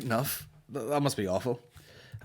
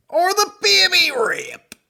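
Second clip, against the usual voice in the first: much higher, louder, cracking; strained high outburst; 8 dB louder, slower than usual. Real, not acted. Sick from the news, a high strained outburst. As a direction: squeal it out in revulsion.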